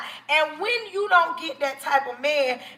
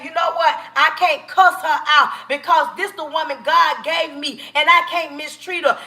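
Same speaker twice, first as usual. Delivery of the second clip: normal talking voice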